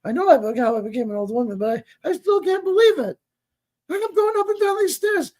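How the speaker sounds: put-on high, wavering old-lady voice; high mock-quavery mom; imitating old mom. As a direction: mimic your elderly mother's disbelief. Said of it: "she goes".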